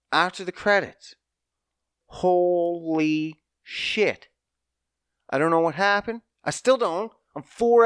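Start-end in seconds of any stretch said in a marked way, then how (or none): 2.1-4.3 slow, drawn-out holy shit; stunned. Real, not acted. Recalling the shock after the credits, drawn-out stunned curse. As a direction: let the shock stretch out. Reacting to the twist.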